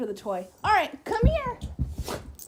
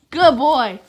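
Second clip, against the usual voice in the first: loud praise; 11 dB louder than usual. Real, not acted. Owner praising the dog loudly. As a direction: boom the praise.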